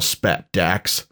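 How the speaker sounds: ordinary delivery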